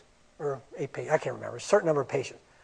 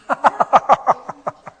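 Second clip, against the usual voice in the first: breathy unvoiced laugh; 6 semitones higher, 4 dB louder than usual. Real, not acted. Laughing at chart excuses.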